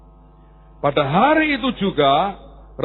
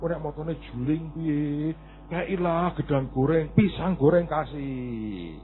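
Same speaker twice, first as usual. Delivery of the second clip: grandmother in Javanese; 4 semitones lower, 8 dB quieter, slower than usual. Retold or imitated. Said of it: "gantian omanya".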